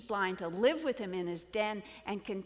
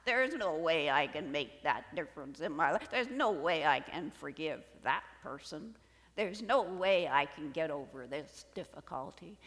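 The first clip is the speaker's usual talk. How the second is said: lower, louder, wavering sheep-like whine; fearful bleating; 7 semitones lower, 5 dB louder than usual. Acted. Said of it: "bleating with fear".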